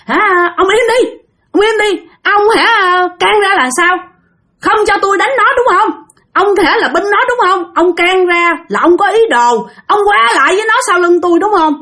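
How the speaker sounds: high, furious snapping; scolding boyfriend. Retold or imitated. Jealous girlfriend yelling at her boyfriend who steps in. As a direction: shut him down hard. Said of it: "cái anh này bị chửi luôn".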